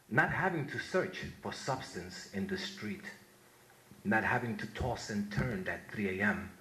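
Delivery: slow, solemn reading of an elegy; poem recitation. Acted. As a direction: read it like a eulogy for a fallen brother. Recites his poem A Job.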